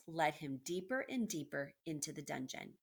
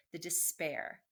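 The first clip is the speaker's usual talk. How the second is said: usual speaking voice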